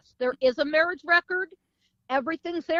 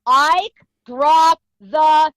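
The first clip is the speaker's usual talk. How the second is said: shouted command; 3 semitones higher, 11 dB louder, much slower than usual. Retold or imitated. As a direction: call it out as a stern order, word by word. Retold command to a gunman.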